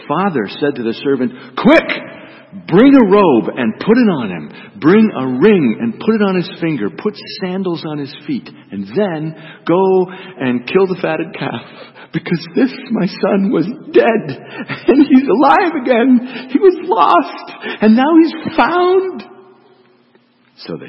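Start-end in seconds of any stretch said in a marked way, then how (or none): 1.6-13.5 loud and much higher, bursting with joy; joyful shout. Acted. Father orders servants, overjoyed. 13.5-19.2 loud, high, laughing through the words, last lines slow; laughing with joy. Acted. Father rejoicing over returned son.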